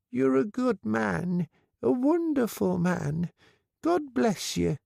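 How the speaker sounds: put-on high, slow grateful old woman; a frail widow blessing him; old lady voice. A narrator's reading.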